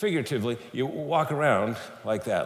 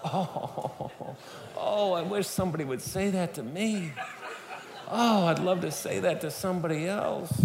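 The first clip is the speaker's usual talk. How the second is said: higher-pitched, gushing mock swoon; swooning romantic; 7 semitones higher than usual. Speaker mocks romantics hearing the movie line, swooning. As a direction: sigh dreamily like a lovestruck romantic. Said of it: "every romantic, when they hear that line".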